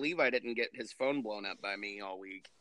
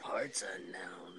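slow breathy whisper; spooky whisper; 6 semitones lower, 9 dB quieter, slower than usual. Pretend. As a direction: hush it like a dark secret. Ominous whispered aside.

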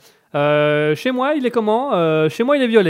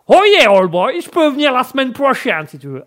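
loud, high cartoon American accent; English-American accent; 6 semitones higher, 5 dB louder than usual. Accent coach offering lessons next week, brash American accent. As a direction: burst in as a brash American old boy. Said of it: "j'adore cet accent anglais".

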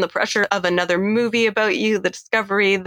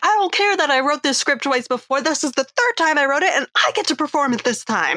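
petulant villain; 7 semitones higher than usual. Retold or imitated. Roman voiced, petulant villain.

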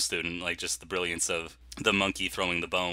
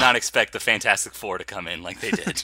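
mock excited; 4 semitones higher, 9 dB louder than usual. Joking excitedly.

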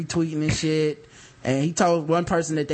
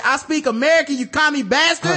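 raised higher and louder, jeering; mock taunt; 8 semitones higher, 3 dB louder than usual. Retelling his tweet comeback to friends, mock jeering. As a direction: fire it back like a cocky insult. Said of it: "And I said".

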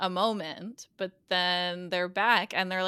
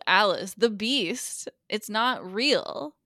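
drawn-out, every word weighed; slow and deliberate; much slower than usual. Retold or imitated. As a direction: spell it out slowly. Doctor speaking slowly, emphatically.

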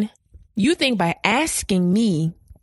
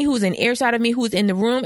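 normal talking voice

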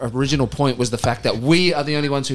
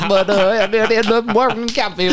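mock Texan accent; 8 semitones higher than usual.

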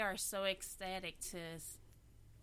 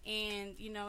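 ordinary talk

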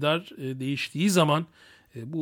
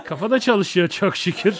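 laughing; 4 semitones higher than usual. Real, not acted. Brags, laughs at himself.

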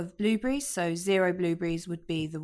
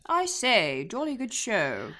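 posh British; 4 semitones higher than usual. Pretend. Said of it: "I'm not gonna do an accent for you yet".

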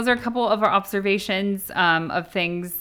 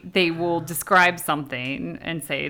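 normal talking voice

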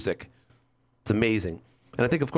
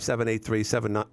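ordinary talk, nothing marked

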